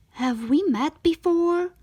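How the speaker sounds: hope she's the one; hopeful question. Acted.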